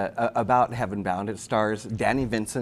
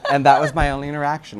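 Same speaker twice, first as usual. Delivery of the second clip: talking through laughter, quicker; laughing wrap-up, slightly loud; 4 semitones higher, 9 dB louder, faster than usual. Real, not acted. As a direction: finish the line still laughing. Still laughing after the story.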